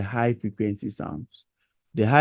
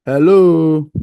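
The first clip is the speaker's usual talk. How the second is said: loud call into the mic; shouting; 5 semitones higher, 10 dB louder than usual. Real, not acted. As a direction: shout to be heard. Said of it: "But I happen to be shouting".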